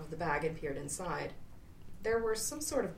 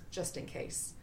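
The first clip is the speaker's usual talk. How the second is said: quiet aside; 4 semitones lower, 6 dB quieter, slower than usual. A narrator's reading. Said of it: "narrator aside".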